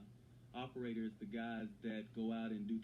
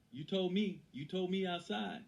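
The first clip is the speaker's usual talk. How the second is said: raised, higher-pitched accusation; louder and higher, calling him out on a promise; acted indignant protest; 8 semitones higher, 5 dB louder, slower than usual. Actor stands up to confront the director, indignant protest.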